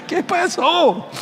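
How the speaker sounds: high, very slow bewildered question; dazed after the fright. Retold or imitated. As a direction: ask it like you just came to. Imita al asustado recuperándose. (pregunta aturdida, muy aguda y lenta)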